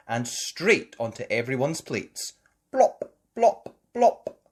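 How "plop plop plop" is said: high, loud, short plops; imitated plops. A narrator's reading. Pancakes landing on everyone's plates, imitated plops. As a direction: pop each word out like a drop.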